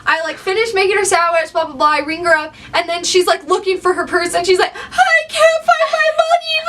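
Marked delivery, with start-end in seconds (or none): 4.8-6.6 hammed-up sob of panic, pitched way up; mock wailing. Crying customer who can't find her money, mock wailing high. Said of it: "in tears this whole time".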